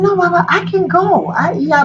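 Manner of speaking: lightly voiced young goddess insisting; eager young Oshun. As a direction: beg to be allowed to go. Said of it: "So Oshun said".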